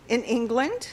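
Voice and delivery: ordinary delivery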